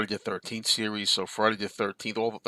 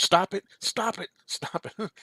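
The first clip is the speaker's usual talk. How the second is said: imitating his wife; 10 semitones higher, slower than usual. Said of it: "She goes".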